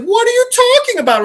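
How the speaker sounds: high, loud, rapid mock disbelief; acted high-pitched startled question; incredulous outburst. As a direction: blurt it out fast and shrieky, imitating someone baffled. Acted startled question in retold story.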